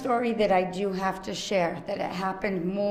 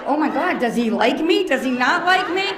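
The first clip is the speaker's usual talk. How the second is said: loud, higher, laughing self-quote; 7 semitones higher, 8 dB louder than usual. Retold or imitated. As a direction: laugh through your own worry. Retelling her anxious reaction.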